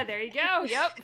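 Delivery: loud, amused agreement; real laughter bubbling through the words; laughing approval. Real, not acted. Amused by whispered advice.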